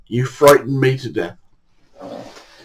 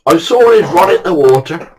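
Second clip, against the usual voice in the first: ordinary talk, nothing marked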